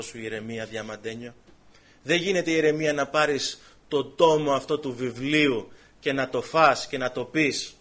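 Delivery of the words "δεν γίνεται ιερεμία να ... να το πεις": preacher drives home the prophet's task; fervent preaching. Acted. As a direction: press the point home to Jeremiah. Preaching to Jeremiah.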